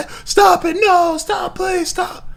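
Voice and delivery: very high, loud pleading shout fading at please; mock frantic husband pleading; imagined yell. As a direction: beg the hippo to stop. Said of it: "You think he was trying to, like, yell that".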